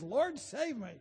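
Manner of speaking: cry for help. Acted. Peter sinking, cries for help.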